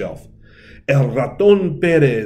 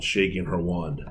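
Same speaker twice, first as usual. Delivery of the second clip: plain reading voice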